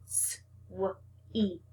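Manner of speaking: S-w-eat split into sounds, whispered; segmented whisper. Shown as a demonstration. Teacher sounds out the word for the class, whispered. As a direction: stretch S-w-eat apart softly, sound by sound. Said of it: "break apart".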